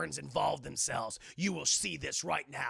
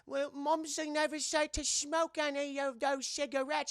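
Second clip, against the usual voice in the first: high, put-on prim English schoolgirl; prim British girl; 8 semitones higher than usual. Janet refusing the cigarette, prim mock British voice.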